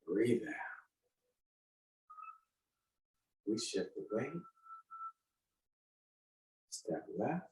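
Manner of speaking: soft, unhurried movement cues; serene tai chi cues. Shown as a demonstration. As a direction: calmly talk them through the form. Leads a tai chi form.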